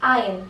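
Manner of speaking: "iron" said EYE-un, silent r; correct pronunciation. Shown as a demonstration. Teacher repeating the model, "iron" as eye-un. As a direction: drop the r in "iron". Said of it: "you will pronounce this as".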